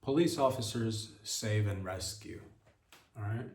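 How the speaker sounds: usual speaking voice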